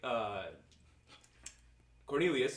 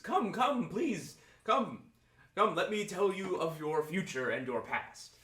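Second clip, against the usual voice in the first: calling out, pitched up at first; fortune teller; 6 semitones higher, slower than usual. Acted. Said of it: "she starts yelling at you and flagging you down".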